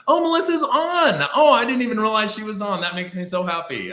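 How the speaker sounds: high-pitched, loud excited outburst; delighted surprise. Real, not acted. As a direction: squeal happily as a friend turns up. Delighted surprise at Melissa joining.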